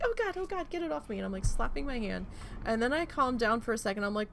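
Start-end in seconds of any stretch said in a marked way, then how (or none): 0.0-1.2 high frantic panic, retold; panicked. Retelling waking to something on her hand, high panicked yelp. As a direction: squeal it like something's crawling on you. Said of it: "freaking out".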